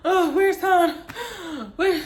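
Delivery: hammed-up breathless bawling; retold crying. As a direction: wail it between gasps. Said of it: "I just start crying".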